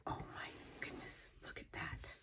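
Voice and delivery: soft breathy whisper of amazement; awed whisper. Real, not acted. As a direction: breathe it out in awe. Hushed awe after a gasp.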